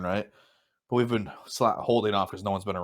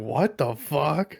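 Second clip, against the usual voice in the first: imitated bafflement; 5 semitones higher, much slower than usual. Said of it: "imagine coming across that and be like".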